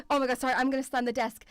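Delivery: usual speaking voice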